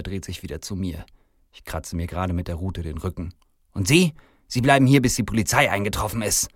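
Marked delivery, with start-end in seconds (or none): 3.7-6.5 high, loud start, stern; irate command. Acted. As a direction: order him to stay put. Manager turns angrily on Santa.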